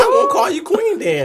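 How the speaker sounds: squeaky high retort; high mock reply. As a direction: throw the line back in a high voice. Retells the reply to her friend.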